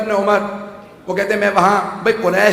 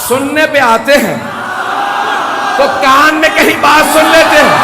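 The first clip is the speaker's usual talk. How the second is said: loud and high, fiery preaching cry; preacher's shout; 6 semitones higher, 6 dB louder than usual. Real, not acted. Preacher mocking the whisper claim, shouting. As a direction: hurl it at the audience in mock outrage.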